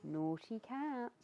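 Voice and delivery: ordinary delivery, nothing marked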